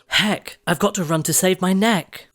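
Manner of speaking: high, urgent shout; shouting in a hurry. A narrator's reading. Cindy shouting as midnight strikes, high and urgent. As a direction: call it out as you rush off. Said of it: "She shouted".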